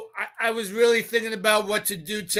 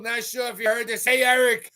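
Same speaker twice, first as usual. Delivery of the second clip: ordinary talk, nothing marked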